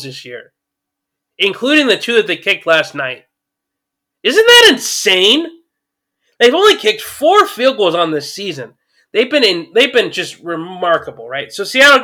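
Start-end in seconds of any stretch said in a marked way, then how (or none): 4.2-7.8 amazed. Real, not acted. Marveling at a stat.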